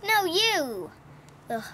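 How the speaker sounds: normal talking voice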